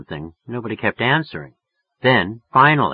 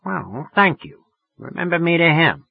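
sociable, higher-pitched woman; suddenly friendly; 7 semitones higher than usual. A narrator's reading. Woman sending regards to a friend, turned friendly. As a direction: turn gracious, voice lifted. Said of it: "She was getting friendly as hell".

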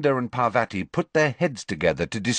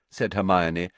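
usual speaking voice

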